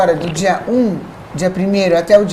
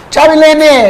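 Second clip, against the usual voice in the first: louder, higher farewell call; calling out goodbye; 6 semitones higher, 6 dB louder, much slower than usual. Real, not acted. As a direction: call out a goodbye to your guest. (despedida em voz alta e aguda)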